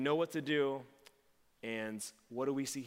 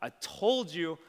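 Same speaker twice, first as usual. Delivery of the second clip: loud, raised-pitch angry accusation; harsh reproach; 5 semitones higher, 7 dB louder than usual. Acted. Mock angry Jesus accuses Peter of denial, harsh. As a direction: scold him hard for letting you down. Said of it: "Jesus isn't saying".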